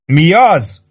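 high bellowed question; bellowing. A narrator's reading. Sergeant bellowing down over the noise. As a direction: roar the question down. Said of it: "bömbölte Warden".

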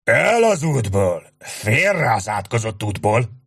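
big Irish sergeant shouting at the crowd; bellowing sergeant. A narrator's reading. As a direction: roar at the crowd to move aside. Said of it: "egy másik hang kiáltott sokkal hangosabban".

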